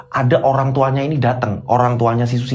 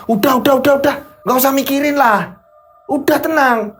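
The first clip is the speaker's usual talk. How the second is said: irritated; 10 semitones higher than usual. Acted. Husband brushing off his wife's worries, high and irritated. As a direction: cut off the worries, voice pitched high.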